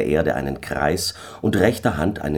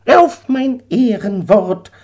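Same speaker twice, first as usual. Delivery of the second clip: much higher, slow, solemn eagle; 6 dB louder, slower than usual. Acted. The eagle swears to Daniel, high and slow. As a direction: vow it slowly in a high bird voice.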